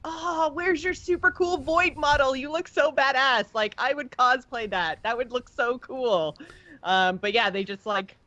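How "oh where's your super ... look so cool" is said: mock-gushing, higher and bright-eyed; hammed-up fangirl praise for the void model; gushing fan. Host retelling her reaction to Illyria, gushing higher. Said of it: "And I'm like".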